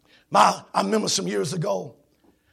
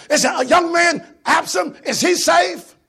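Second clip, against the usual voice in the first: higher, louder, slow worried question; anxious king; 8 semitones higher, 5 dB louder, slower than usual. Acted. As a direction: beg for news of the son. Anxious king asking about son.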